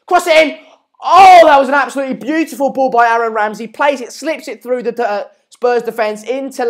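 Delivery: sudden loud, high shout easing into an excited replay call; excited match commentary. Real, not acted. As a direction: erupt at the near goal, then keep the energy up. Live call of a big chance.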